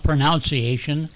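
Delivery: "pronunciation" with NOUNCE as the second syllable; wrong pronunciation. Shown as a demonstration. Co-host cites the host's slip, "pronunciation" said pro-NOUNCE-iation. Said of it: "You don't call it pronunciation".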